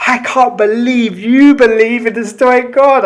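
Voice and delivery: hammed-up incredulous critic; mock critic. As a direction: ham up the shocked critic. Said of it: "and then people go".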